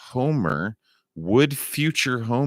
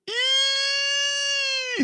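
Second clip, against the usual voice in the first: high squeal. Real, not acted. Reacts to the dreaded card.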